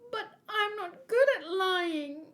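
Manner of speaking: high, small and hesitant; timid mermaid princess. Acted. The mermaid princess shyly refusing the prince, high and small.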